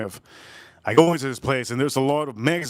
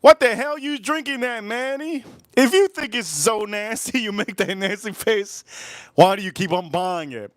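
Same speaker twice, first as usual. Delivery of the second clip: high-pitched, incredulous telling-off; mock scolding; 7 semitones higher than usual. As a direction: scold an absent drinker in a squeaky, exasperated voice. Mock scolding a disgusted YouTuber.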